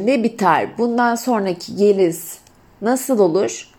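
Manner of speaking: ordinary talk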